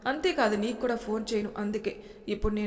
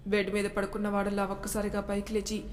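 usual speaking voice

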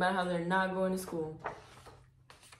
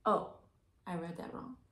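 plain reading voice

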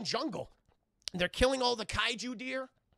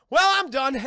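loud, higher, satisfied; 8 semitones higher, 15 dB louder than usual. Retold or imitated. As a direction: belt it out, done and proud. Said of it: "Oh, no, before that".

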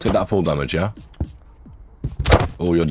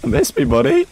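sing-song taunt, far higher; mocking high taunt. Taunting an opponent who missed, squeaky high mock. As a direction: tease him in a squeaky voice.

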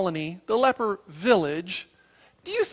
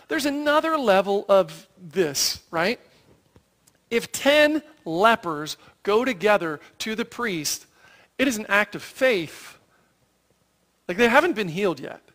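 normal talking voice

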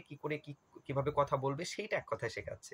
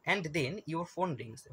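slow question read; much slower than usual. Shown as a demonstration. Reading exam question aloud.

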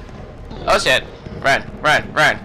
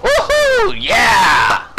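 victory whoop; much slower than usual. Real, not acted. Player whooping after the grenade kill. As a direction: cheer the win.

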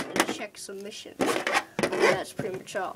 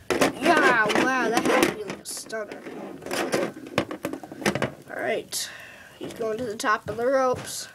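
wrestling commentator. Pretend. Plays wrestling commentary with figures.

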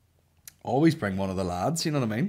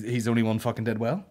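normal talking voice

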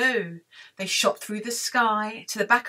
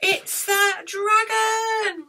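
high, drawn-out dramatic reveal; mock alarm; 10 semitones higher, 3 dB louder, much slower than usual. Storyteller reveals dragon to children, mock alarm.

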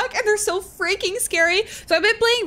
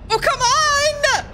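high, slow exasperated wail; high drawn-out complaint; exasperated cry; 9 semitones higher, slower than usual. Real, not acted. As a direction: wail at the game in frustration. Dog chases her again.

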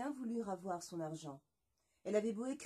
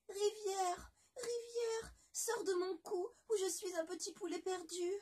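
high, trembling tearful call; desperate plea; 10 semitones higher than usual. Acted. La moitié de poulet supplie la rivière, voix tremblante. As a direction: beg the river in fear. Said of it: "se dépêcha de murmurer".

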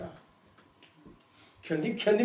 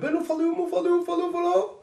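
awed; 10 semitones higher, 4 dB louder, much faster than usual. Retold or imitated. Recalling the earthquake vision to friends, high awed voice. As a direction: voice climbing high in awe.

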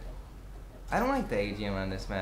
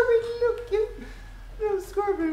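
put-on much higher, sing-song baby talk; mock cute voice. Gamer taunting a window camper with mock baby talk. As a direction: coo at the camper like he's a baby.

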